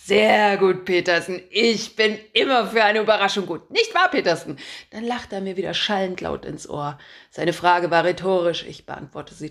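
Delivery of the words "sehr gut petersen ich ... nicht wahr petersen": loud, brash editor barking orders; a pushy chief editor who loves his own voice; booming boss. Acted. Chief editor calling his reporter, booming and pushy.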